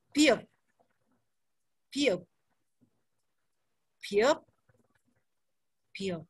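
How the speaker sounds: piup as separate words, the first sound puffed like P; aspirated Korean B. Shown as a demonstration. Teacher drilling the letter for learners, piup lightly puffed. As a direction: model piup slowly with a puff of breath. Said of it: "ada hembusan nafasnya".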